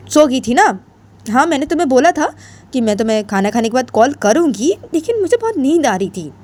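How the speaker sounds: a hint of a defensive friend; Ritu's voice. Narrator voices Ritu explaining.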